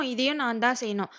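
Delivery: ordinary delivery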